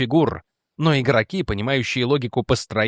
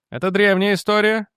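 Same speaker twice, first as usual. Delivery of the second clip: higher-pitched impatient interruption; raised pitch, butting in; interrupting; 6 semitones higher than usual. A narrator's reading. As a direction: cut in to dismiss the point. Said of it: "перебил Элснер".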